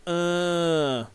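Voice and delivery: short mock grunt; imitating a grunt. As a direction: grunt it like the character. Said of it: "Make him say".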